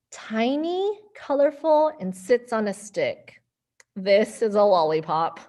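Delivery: put-on lively storybook voices for kids; reading to children. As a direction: read it playfully to little ones. Reading picture book to kids.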